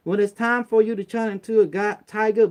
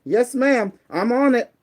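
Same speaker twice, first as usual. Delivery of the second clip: louder, higher yes sir to the boss; eager employee; 6 semitones higher, 6 dB louder, slower than usual. Shown as a demonstration. Telling a worker how to answer the boss, eager and louder. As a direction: sound keen to please the boss. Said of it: "go, eh".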